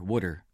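water said low as "wooder"; Jersey water. Shown as a demonstration. Jersey native joking about spelling, water as "wooder". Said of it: "it's spelled W O O D E R".

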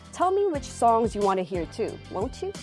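ordinary talk, nothing marked

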